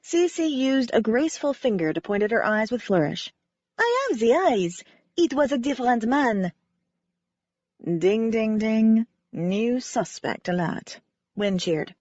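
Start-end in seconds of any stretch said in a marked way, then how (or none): 3.8-6.5 lightly voiced French ballerina, sincere; a hint of French accent, remembering gratefully. Ballerina Cece telling the amateur sleuth how Wynne saved her, light French accent. Cece's voice continues.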